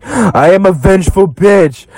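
mock drunk. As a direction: slur it like a tipsy housewife. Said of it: "drunk as hell again".

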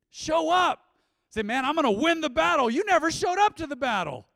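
high, loud preaching with every line pushed out; full-force preaching. Acted. Pastor exhorting the congregation to show up, in loud full-force preaching. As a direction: preach it hard and drive the point home.

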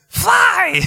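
high, loud mock yell from the back seat; a mother imitating her girls yelling from the back seat; imitated kids' shout. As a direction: shriek the word like a panicked little kid. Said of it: "all I hear is".

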